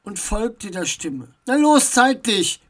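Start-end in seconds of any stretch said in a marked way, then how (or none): 1.5-2.6 loud, higher-pitched order; impatient command to come out. A narrator's reading. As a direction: demand it forcefully. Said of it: "befahl Max".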